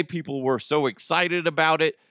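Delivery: ordinary delivery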